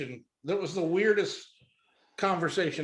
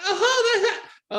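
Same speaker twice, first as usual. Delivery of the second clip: high, flustered mock giggle; very high nervous titter; 6 dB louder than usual. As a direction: imitate a woman laughing awkwardly. Caller laughs nervously at offer.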